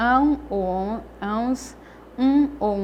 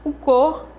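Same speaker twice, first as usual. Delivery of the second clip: "cor" louder and higher, stressed; sílaba forte; 4 semitones higher, 4 dB louder than usual. Shown as a demonstration. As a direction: punch "cor" as the strong syllable. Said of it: "eu pronuncio com mais força". ("cor" com mais força)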